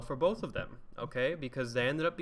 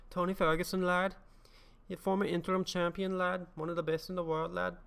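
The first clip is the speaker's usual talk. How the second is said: higher-pitched, hammed-up Scouse brag on the mic; mock Liverpool accent; 7 semitones higher than usual. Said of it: "That's a bit more of a Darren Till voice".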